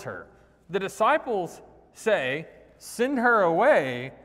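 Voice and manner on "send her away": loud, slow, pressing demand; urgent demand. Acted. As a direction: push them to send her off, slowly and loudly. Disciples urge sending woman away.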